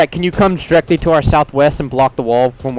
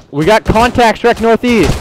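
loud callout; 8 semitones higher, 4 dB louder than usual. Real, not acted. Calling contacts to squad.

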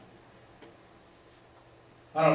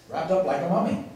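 ordinary delivery

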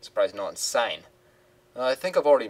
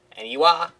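higher, surprised woman's voice; Simone's voice; 6 semitones higher, 5 dB louder than usual. Acted. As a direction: put on a chirpy TV reporter. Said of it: "her voice is hard to do".

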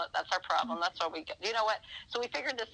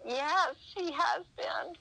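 much higher, slow, overly agreeable; mock eager agreement; 11 semitones higher, slower than usual. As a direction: play along sweetly and overdo it. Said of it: "And I said".

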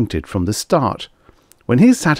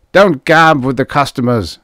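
loud, raised scolding man; angry, strident; 7 semitones higher, 6 dB louder than usual. A narrator's reading. Boss scolds the girl over the phone, angry. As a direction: snap the order stridently. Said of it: "The man's voice was angry, strident".

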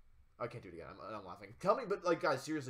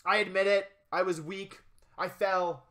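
mock confession; 4 semitones higher, 7 dB louder than usual. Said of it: "make me say it again".

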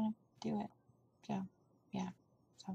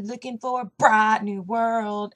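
slow, louder imitated country twang; 5 dB louder, slower than usual. Child copying a country singer's lyric, exaggerated country accent. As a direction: draw it out in a thick country accent. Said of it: "sings it in the perfect country accent, like [name]".

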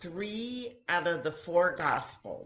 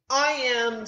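boastful claim; 9 semitones higher, 9 dB louder than usual. Acted. Disciples arguing who is greatest.

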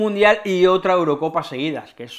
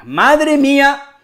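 anxious wail; 9 semitones higher, 5 dB louder, much slower than usual. Real, not acted. Anxious exclaim.

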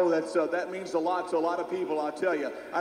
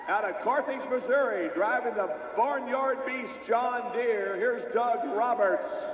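tractor pull announcer; 3 semitones higher than usual. Real, not acted. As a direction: announce each driver to the crowd. Driver introductions at tractor pull.

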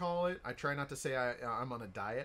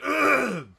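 sharp choking cough; loud cough; 11 semitones higher, 14 dB louder than usual. Real, not acted. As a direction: hack it out loud. Coughing fit continues.

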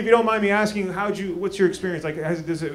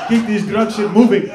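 Benga imitation in accent; 8 dB louder than usual. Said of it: "so you don't trust nobody".

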